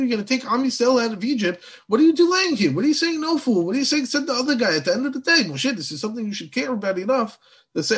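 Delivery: high, loud, incredulous reproach; exasperated scolding. Retold or imitated. God to Moshe, who is delaying the mission, exasperated scolding.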